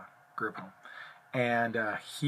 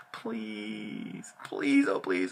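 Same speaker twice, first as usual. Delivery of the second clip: mock begging; 2 semitones lower, 4 dB quieter, much slower than usual. Retelling a client begging staff for a bat, mock plea. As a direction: imitate a client whining please over and over.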